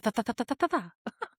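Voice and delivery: fast "ta-ta-ta" lisped gibberish imitating Spanish; machine-gun imitation of fast Spanish; rapid mock-Spanish babble. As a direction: rattle off nonsense syllables like quick Spanish, then laugh. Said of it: "really fast".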